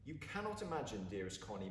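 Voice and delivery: plain reading voice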